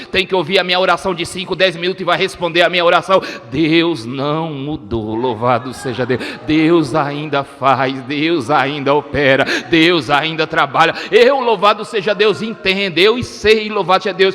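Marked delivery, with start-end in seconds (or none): 3.5-10.9 low, slow, weighty proclamation; emphatic pulpit refrain, deep and deliberate; solemn preaching declaration. Real, not acted. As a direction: drop low and draw out each word.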